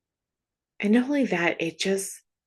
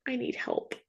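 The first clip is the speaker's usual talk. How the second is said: put-on softer, small toddler voice; a tiny plea like a small child; a toddler softly asking a parent for help; little toddler; 5 semitones higher, 10 dB quieter than usual. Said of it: "the little toddler looks at you and says".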